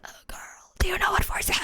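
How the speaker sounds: fast voiceless whisper; airy, whispered croak of a lost voice; voice gone. Retold or imitated. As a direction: imitate having lost your voice, barely any sound. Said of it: "'Cause my voice was so gone".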